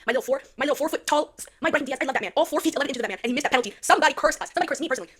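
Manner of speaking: loud, worked-up complaining; exasperated rant. Real, not acted. Fan ranting about a missed penalty and a curse, exasperated. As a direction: rail against your bad luck.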